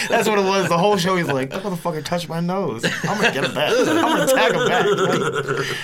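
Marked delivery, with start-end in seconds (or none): 1.5-4.9 quoting a friend while laughing. Retold or imitated. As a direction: laugh through the quote, pitched high. Retells friend's revenge, laughing.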